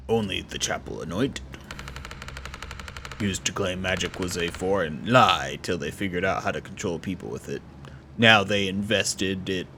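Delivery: low, measured put-on voice; deep-voiced game character.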